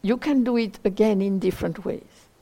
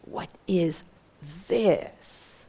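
pause, then "this" raised and drawn out; stress on THIS; 2 semitones lower, much slower than usual. Shown as a demonstration. Teacher tests the last word as the point, stressing "this". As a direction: hold back, then land on "this". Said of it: "Or is maybe the this kind of really the point?".

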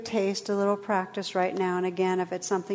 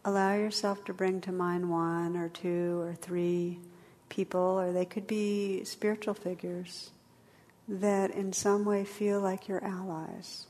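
guided meditation; 3 dB quieter than usual. Acted. Leads a meditation.

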